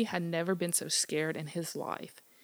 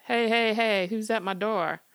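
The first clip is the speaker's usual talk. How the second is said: called out; 4 semitones higher, 6 dB louder than usual. Acted. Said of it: "Smitty called out".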